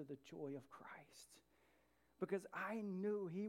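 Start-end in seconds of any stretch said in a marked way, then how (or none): 0.0-1.4 whispered. Real, not acted. Hushed line in a sermon.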